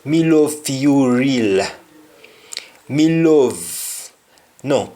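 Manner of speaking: "Me love fi you real" in a Jamaican Patois lilt; a Patois line of devotion. Shown as a demonstration. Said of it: "we say".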